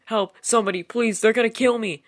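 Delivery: veel hoger en luider, doodsbang; terrified scream for help. Acted. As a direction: schreeuw in doodsangst. Screaming that they'll kill her.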